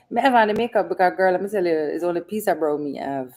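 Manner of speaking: light mock Jamaican patois; joking Jamaican patois. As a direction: slip into Jamaican patois to joke. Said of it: "I'm just kidding".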